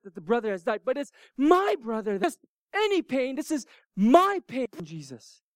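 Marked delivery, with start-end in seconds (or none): 1.3-2.2 "my" hit high and loud; forceful, raised emphasis on "my"; stress on MY. Shown as a demonstration. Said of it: "more of an emphasis". 2.2-4.7 high, loud punch on "my"; insistent, hammering the word "my"; emphatic MY. Acted. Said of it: "it's an emphatic emphasis".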